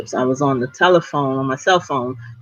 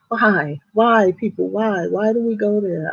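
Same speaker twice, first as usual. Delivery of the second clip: exasperated; 6 semitones higher than usual. Real, not acted. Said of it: "exasperated aside to viewers".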